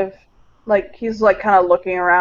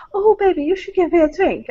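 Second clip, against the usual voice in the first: flirty woman; 11 semitones higher than usual. Acted. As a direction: coo it like a woman hitting on him at the bar. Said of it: "came over and she said".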